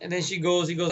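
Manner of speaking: ordinary talk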